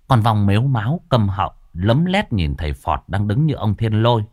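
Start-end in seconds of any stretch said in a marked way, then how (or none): none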